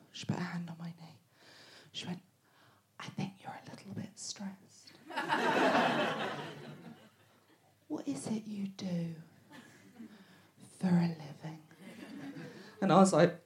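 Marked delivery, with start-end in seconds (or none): 0.1-11.6 hushed unvoiced whisper. Doctor leaning in to her patient, gentle whisper. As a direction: lean in and breathe it softly.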